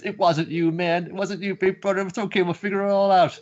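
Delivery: high, earnest comfort; calm him down, it's not his fault; reassuring brother. Acted.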